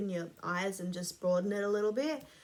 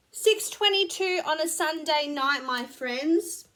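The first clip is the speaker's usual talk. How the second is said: bright announcement; 6 semitones higher, 7 dB louder than usual. Real, not acted. Cheery sign-off announcement.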